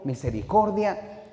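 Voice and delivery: usual speaking voice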